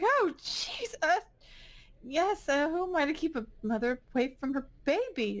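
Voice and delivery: startled, high-pitched, halting backdown; flustered nurse. Acted.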